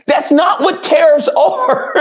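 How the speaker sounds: high, slow words breaking into laughter; laughing disbelief. Real, not acted. Laughing disbelief at tariff logic.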